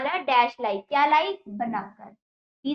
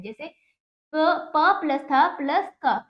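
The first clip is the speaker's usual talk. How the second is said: ordinary talk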